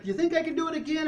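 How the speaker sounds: high, quick question to a crowd; rally the onlookers with a rapid question; showman's challenge. Acted. Tightrope walker asking his crowd, excited challenge.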